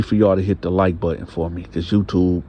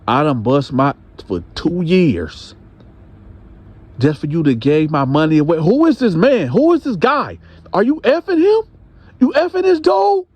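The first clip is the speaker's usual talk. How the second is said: retold rage climbing to high yells; husband demanding answers, rising to shouts; angry husband; 9 semitones higher, 4 dB louder than usual. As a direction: build from stern to high furious shouting. Husband confronts wife over money.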